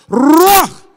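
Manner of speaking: Ruach roared, R trilled long; rolled R. Shown as a demonstration. Preacher showing Hispanics how to roll it. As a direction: belt out Ruach with a big rolled R. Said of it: "roll that R".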